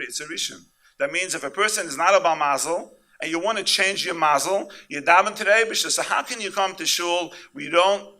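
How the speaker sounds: loud, high fervent sermon voice; preaching. Acted. Rabbi preaching to the congregation, fervent and loud. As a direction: drive it home to the crowd.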